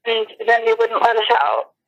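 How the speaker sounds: ordinary talk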